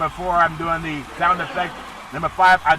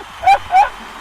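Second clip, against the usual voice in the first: high squealing giggle; 5 dB louder than usual. Pretend. After Scarface line.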